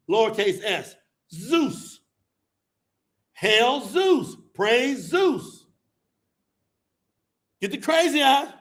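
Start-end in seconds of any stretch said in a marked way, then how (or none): none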